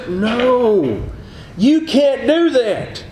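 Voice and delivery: high, drawn-out refusal; emphatic forbidding. Acted. Preacher voicing the devil banning school prayer, emphatic forbidding. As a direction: lay down a slow, emphatic ban.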